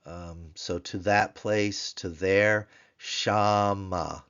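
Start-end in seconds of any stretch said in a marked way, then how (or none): 3.0-4.2 Shama, SHA stressed; teacher stressing the tone syllable; stress on the first syllable. Shown as a demonstration. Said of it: "Notice the accent, the tone syllable on the P note".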